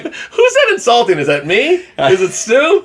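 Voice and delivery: high-pitched incredulous questions; mock bewilderment. Podcaster reacting to buddy's Facebook jersey joke, mock bewilderment. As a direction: pitch it way up in playful confusion.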